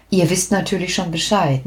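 ordinary delivery, nothing marked

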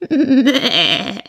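high, long goat bleat. Acted. Goat bleats as she leaves. (langgezogenes Meckern einer Geiß)

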